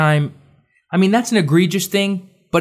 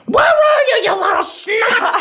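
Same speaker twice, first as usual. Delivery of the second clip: very high, hammed-up screech; stretched-out comic scolding; mock furious shout; slower than usual. Dumbledore hunting Harry before all students, mock furious screech. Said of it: "It doesn't say that he's like".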